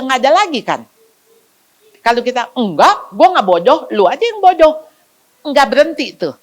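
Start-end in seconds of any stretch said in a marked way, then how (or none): 2.5-4.8 louder, mock-indignant comeback; defensive retort. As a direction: snap back like an offended kid. Child snapping back at insult.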